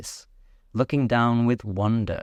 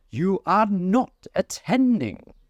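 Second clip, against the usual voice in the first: high, slow stern scolding; severe; 11 semitones higher, 3 dB louder, slower than usual. A narrator's reading. Mouse scolding Alice, high and severe. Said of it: "said the mouse to Alice severely".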